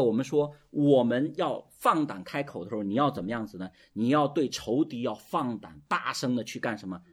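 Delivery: ordinary delivery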